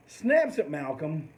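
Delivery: normal talking voice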